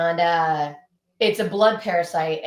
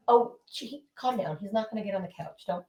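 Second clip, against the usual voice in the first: soft scolding to dog; 6 dB quieter than usual. Real, not acted. Scolding her dog softly.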